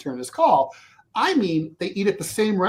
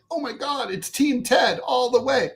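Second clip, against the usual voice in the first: high, thrilled gush; mock-celebrate like a fan; giddy delight; 8 semitones higher than usual. Thrilled by the judge's rulings.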